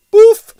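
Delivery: loud, very high contemptuous poof; dismissive explosive poof; scornful poof. A narrator's reading. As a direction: blow the word away in contempt. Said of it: "angry mother dismissing".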